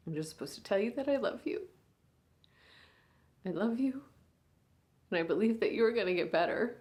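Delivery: a big sister at a hospital bedside, swinging between hushed and raw; hushed, aching bedside monologue; grieving big sister. Acted. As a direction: speak to your unconscious little sister, holding back tears. Sister talks to comatose sibling.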